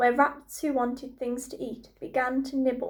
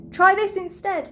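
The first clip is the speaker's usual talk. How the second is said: higher, loud threat; yelled retort; 7 semitones higher, 6 dB louder than usual. A narrator's reading. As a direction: yell back with menace. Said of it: "the prince yelled back".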